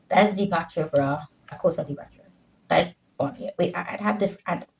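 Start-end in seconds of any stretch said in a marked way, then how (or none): none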